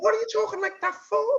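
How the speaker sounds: very high, quick baffled question; mock incredulous. Wife asking husband why he talks like that, high mock disbelief. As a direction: squeak out your disbelief, hammed up.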